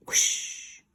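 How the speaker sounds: breathy rushing "Whoosh!"; whooshing effect. A narrator's reading. Narrator voicing the jump as a breathy whoosh. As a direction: blow the word like wind.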